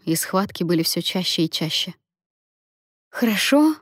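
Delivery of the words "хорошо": high, rising moan of disbelief. A narrator's reading. Labouring Lia answers her comforter, moaning in disbelief. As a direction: groan it as an indignant question. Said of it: "простонала она".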